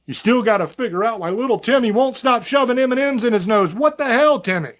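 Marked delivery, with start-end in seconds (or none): 2.2-4.7 exasperated shout. Pretend. Scolding a kid with M&Ms.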